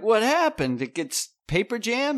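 surprised question. Retold or imitated. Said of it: "they're like".

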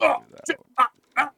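high, loud startled cries; mock startled yelps. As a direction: act out a jump scare. Imitating video sound effects.

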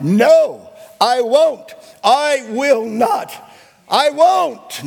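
loud, high defiant no; a full-voiced yell of refusal; shouted refusal. Shown as a demonstration. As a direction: shout it at the devil. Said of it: "You have to shout".

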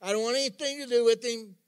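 loud, high-pitched mock refusal; defiant refusal. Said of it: "they're just going".

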